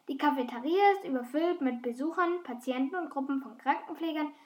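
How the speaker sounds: ordinary delivery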